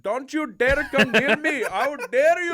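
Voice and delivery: loud, high, slipping into Middle Eastern; bad Australian accent. Shown as a demonstration. Demonstrating for a co-host, Australian accent gone Middle Eastern. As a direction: shout a botched Australian accent indignantly. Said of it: "I'm gonna do an Australian accent".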